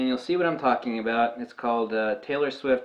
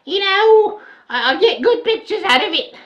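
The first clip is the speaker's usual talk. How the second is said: put-on high, laughing Australian accent with a "mate"; higher mock Australian accent, laughing; mock Aussie voice; 8 dB louder than usual. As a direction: play a cheerful Aussie bragging about his lens. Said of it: "someone says".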